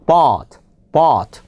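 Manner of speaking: "but" with an open, unrounded vowel, not oh; "but" clearly unlike "boat"; open English vowel. Shown as a demonstration. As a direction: say "but" low, jaw dropped on the vowel. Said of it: "but 전혀 달라요".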